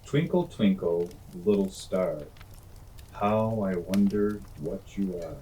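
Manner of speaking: poem recitation. Acted. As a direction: recite gently to children.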